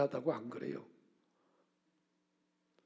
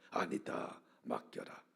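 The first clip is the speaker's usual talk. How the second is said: God's reply; 8 dB quieter, slower than usual. Retold or imitated. God answers pastor.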